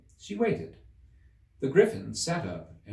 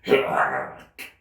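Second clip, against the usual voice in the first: griffin exclamation; 3 semitones higher, 7 dB louder than usual. A narrator's reading. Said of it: "an occasional exclamation".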